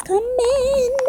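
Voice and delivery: high, slow, drawn-out "coming"; nervous warning. Real, not acted. Player watching the flood arrive, high drawn-out "coming".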